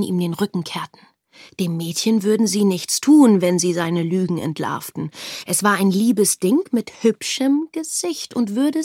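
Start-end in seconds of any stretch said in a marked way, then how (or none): none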